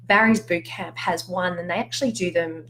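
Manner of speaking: ordinary talk, nothing marked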